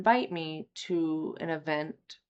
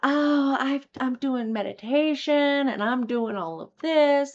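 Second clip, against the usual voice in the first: high, sing-song parody of smug sharers; mock the sugarcoated sharing, pitched up; sarcastic chirpy imitation; mocking fake-cheerful; 7 semitones higher, 6 dB louder than usual. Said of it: "most people get up there and just are all".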